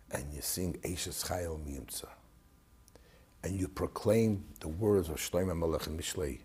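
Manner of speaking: father's words retold in a hushed, dropping voice, near a whisper on the singing line; low, hushed retelling. Father quietly lecturing his son about Friday night, hushed. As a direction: keep it low and intimate, almost whispered. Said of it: "he was mamish talking so low".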